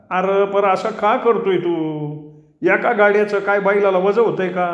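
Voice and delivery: put-on puzzled old farmer. A narrator's reading. Bapu questions narrator's anger.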